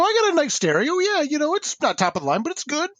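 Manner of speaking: high-pitched, keen reply; retold eager answer. Student telling the editor about his stereo, eager. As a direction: answer keenly, pitch jumping up.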